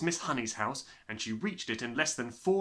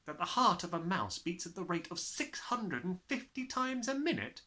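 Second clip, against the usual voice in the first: Matilda; 7 semitones higher than usual. Acted.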